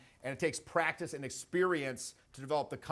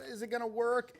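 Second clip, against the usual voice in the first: half-hearted doubt; 6 semitones higher than usual. Retold or imitated. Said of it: "If you half-ass it".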